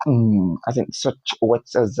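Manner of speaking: ordinary delivery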